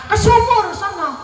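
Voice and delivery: high-pitched curt order; imitated bossy mother. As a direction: send the child off as an exasperated mother. Mother orders child to the well.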